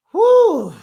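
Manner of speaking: high, loud whew; big whew. Real, not acted. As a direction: let out a relieved whoop of breath. Relief after long rant.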